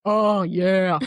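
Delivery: long, loud mock groan; imitated moaning guy. Guy in the other room, mock moaning. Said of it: "that's happening in the other room".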